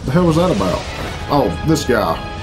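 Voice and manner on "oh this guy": ordinary talk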